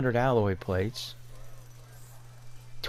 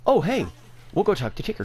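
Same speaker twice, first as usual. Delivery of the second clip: excited realization; 6 semitones higher, faster than usual. Real, not acted. Sudden idea about Ticker.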